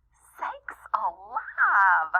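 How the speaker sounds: slow, breathy high gasp of shock; gasped exclamation. Acted. Dad seeing the crowded mall, slow shocked gasp. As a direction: gasp it out, stunned. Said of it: "he gasped".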